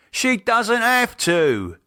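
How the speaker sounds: dad insisting to daughter; insistent. Acted. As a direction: spell it out slowly.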